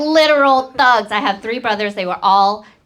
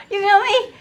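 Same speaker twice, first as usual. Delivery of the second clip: ordinary delivery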